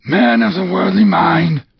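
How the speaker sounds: high, loud wailing spectre; eerie ghost; ghost's voice. Acted. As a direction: boom down at Scrooge.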